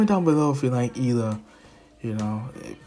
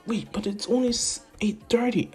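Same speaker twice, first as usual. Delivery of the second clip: high protest; 10 semitones higher than usual. Retold or imitated. Protesting early bedtime.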